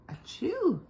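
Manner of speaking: high, loud acted sneeze; cartoon achoo; big sneeze. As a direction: let out a huge bear sneeze. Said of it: "the pepper made him sneeze".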